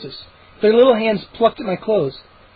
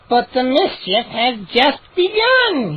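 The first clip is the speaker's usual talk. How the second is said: higher, slow, gloating gnome voice; 5 semitones higher, slower than usual. Acted. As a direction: savor the threat. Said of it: "he declared".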